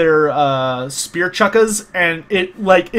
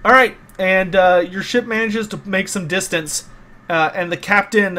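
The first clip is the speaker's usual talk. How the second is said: ordinary talk, nothing marked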